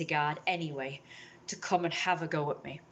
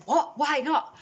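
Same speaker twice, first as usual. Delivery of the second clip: high, louder retold protest; indignant disbelief; 9 semitones higher, 5 dB louder, much slower than usual. Banned customer questioning cafe staff, indignant and high-pitched. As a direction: re-enact being baffled by a refusal. Acts own protest at being refused.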